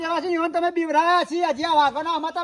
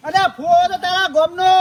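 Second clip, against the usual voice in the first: loud, furious retort; angry shout; 2 semitones higher, 6 dB louder than usual. Acted. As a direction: shout him down. Shouting insult in quarrel. (ગુસ્સામાં મોટેથી બૂમ)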